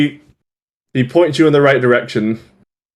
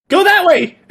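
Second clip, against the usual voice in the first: put-on high-pitched eager kid voice; young boy. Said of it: "like, twelve".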